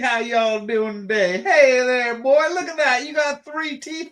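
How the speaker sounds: high, hammed-up Mississippi yokel accent. Co-host greets a toothless local in mock Mississippi accent.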